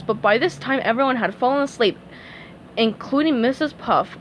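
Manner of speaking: ordinary delivery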